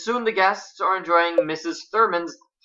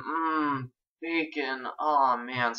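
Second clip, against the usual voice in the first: savouring aside; 2 semitones lower, 7 dB quieter, much slower than usual. Real, not acted. Narrator distracted by bacon, dreamily savouring. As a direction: linger hungrily over the bacon.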